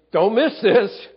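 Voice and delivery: high and loud, urgent warning. Retold or imitated. Preacher voicing John urging readers not to miss it, urgent. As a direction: grab their attention sharply.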